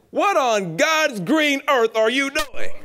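high, slow, loud scolding granny voice; a stern grandmother catching kids at mischief. Retold or imitated. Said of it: "She said".

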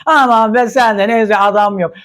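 lightly voiced tipsy diva; a hint of a tipsy scoff; mock drunk woman. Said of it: "Aynen böyle sarhoş gibi". (hafif sarhoş kadın taklidi)

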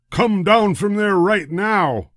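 loud, high, slow holler. A narrator's reading. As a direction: holler up at the monkey. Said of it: "he hollered at George".

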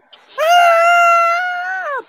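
very high shrieking crowd scream; a high held scream of screaming women; imitated fan scream. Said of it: "oigo un griterío de mujeres".

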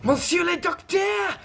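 a French inspector pointing out the circle; French accent. Acted.

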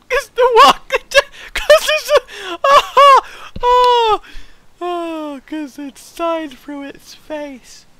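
high squeaky words through laughter; can barely talk for laughing; laughing fit. Real, not acted. Gamer cracking up at a sign, laughing.

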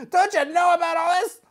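high strained demand, rising at the end; upset figure. Pretend. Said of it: "he's upset".

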